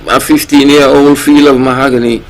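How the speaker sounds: ordinary delivery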